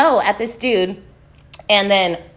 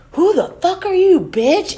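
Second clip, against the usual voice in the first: high, indignant mock snarl; retold hostile challenge; 10 semitones higher than usual. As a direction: imitate an aggressor squaring up.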